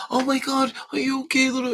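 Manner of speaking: high panicked mom voice; mother overreacting to a fall, high and loud. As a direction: gush over the baby in alarm. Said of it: "my mom used to do it".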